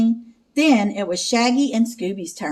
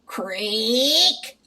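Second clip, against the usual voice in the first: usual speaking voice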